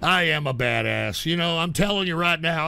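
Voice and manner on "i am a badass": low-pitched, deliberate repeat; self-affirmation mantra. Real, not acted. Streamer repeating a positive reinforcement affirmation, low mantra. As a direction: pitch it well down, like convincing yourself.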